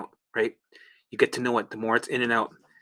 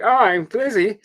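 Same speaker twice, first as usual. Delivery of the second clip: mock dizzy moan; 8 semitones higher, 6 dB louder, slower than usual. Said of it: "every time you're like".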